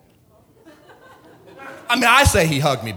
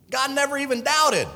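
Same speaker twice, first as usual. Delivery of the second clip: ordinary delivery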